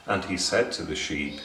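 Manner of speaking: plain reading voice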